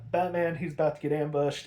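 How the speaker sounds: ordinary talk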